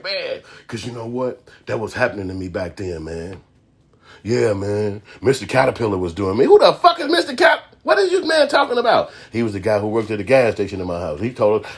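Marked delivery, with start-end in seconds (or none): 0.0-6.4 low, quiet, weary admission; mock haunted confession. Acts haunted Dudley recalling abuse. 6.5-9.1 high-pitched, incredulous demand; baffled outburst. Retold or imitated. Acts baffled reaction to confession.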